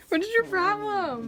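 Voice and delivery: high, loud playful shout; loud teasing. Real, not acted. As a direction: yell it teasingly. Teasing someone off camera.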